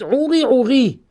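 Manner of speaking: "Ouri" with high, weighted "Ou"; stress on the first syllable. Shown as a demonstration. Teacher introduces the second pair, "Ouri" stressed on "Ou". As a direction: hit the "Ou" of "Ouri". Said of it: "sur la première syllabe".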